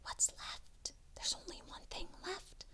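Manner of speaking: suspense whisper. A narrator's reading.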